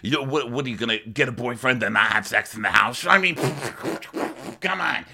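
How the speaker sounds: high-pitched hammed-up arguing; Frank. Frank arguing about the sleepover rule, high incredulous impression. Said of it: "He goes".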